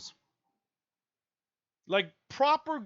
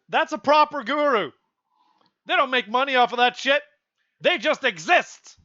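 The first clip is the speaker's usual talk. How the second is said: higher and louder, insisting; emphatic declaration; 5 semitones higher, 6 dB louder than usual. Real, not acted. Vlogger defining a real guru, emphatic declaration. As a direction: hammer the point home.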